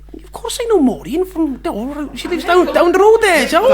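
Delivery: high, swooping mock Welsh; Welsh accent. Playing along about Maureen, high Welsh accent. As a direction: exaggerate the Welsh lilt, pitched right up. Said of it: "impressed with that Welsh accent".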